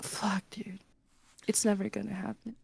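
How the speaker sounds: hushed aside. Retold or imitated. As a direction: drop it to a resigned hush. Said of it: "And I'm like".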